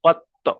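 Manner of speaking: tajweed demo of the bouncing ত in কত; qalqalah echo. Shown as a demonstration. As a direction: strike the ত in কত so it springs back. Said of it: "আবার সামান্য একটু আওয়াজটা একটু ফিরে আসছে".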